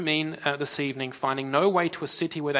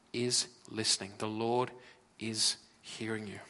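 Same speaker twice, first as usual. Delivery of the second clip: tender reassurance; 5 semitones lower, 7 dB quieter than usual. Real, not acted. Preacher reassures listeners.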